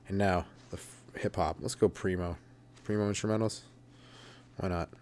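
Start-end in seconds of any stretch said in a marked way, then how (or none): none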